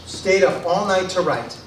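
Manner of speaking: ordinary delivery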